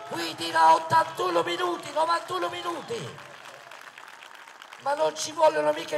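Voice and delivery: loud rally oratory, projected to the crowd; booming balcony speech. Acted. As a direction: proclaim it from the balcony.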